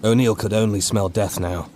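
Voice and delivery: ordinary delivery, nothing marked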